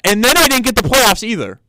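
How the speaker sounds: high, loud complaint; ranting at the injustice; exasperated outburst. Acted. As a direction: erupt in high-pitched sports-fan outrage. Imagined rant about losing to Jets.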